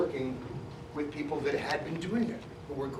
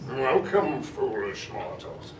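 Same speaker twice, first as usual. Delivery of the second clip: Paul Frees; much slower than usual. Retold or imitated. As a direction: welcome the guests like a haunted house ghost. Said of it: "The voice you hear all throughout it is a brilliant man named Paul Frees".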